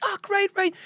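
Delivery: wife pretending she caught it; confused wife. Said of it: "my wife was like".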